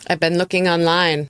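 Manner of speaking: ordinary talk, nothing marked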